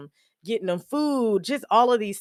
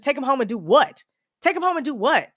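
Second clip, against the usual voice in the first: indignant retort; 8 semitones higher, 5 dB louder than usual. Retold or imitated. Retold retort about dad's discharge.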